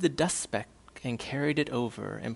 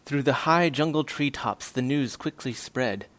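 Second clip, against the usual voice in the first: ordinary delivery, nothing marked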